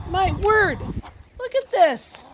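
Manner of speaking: high, slow wonder; amazed. Real, not acted. Amazed at frog page.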